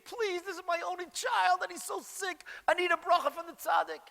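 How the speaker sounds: high-pitched desperate pleading cry; retold scream, pitched far up and imploring; yelling and screaming. Mother begging for a blessing for her sick child, screaming plea. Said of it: "she starts yelling and screaming".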